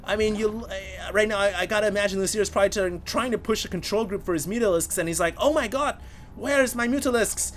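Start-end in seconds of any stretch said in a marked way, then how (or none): 5.4-7.5 put-on poor Korean accent. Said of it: "Forgive my poor Korean accent".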